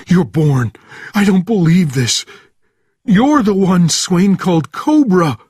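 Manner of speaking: high, loud, agitated shouting; panicked disbelief. A narrator's reading. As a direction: blurt it out in alarm. Sergeant panics, recognising Bourne.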